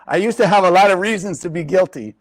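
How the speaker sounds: ordinary talk, nothing marked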